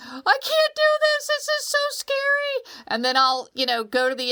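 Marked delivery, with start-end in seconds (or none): 0.1-2.8 put-on much higher frightened little-kid voice; scared child. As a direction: whine it from the end of the board. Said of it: "standing on the end saying".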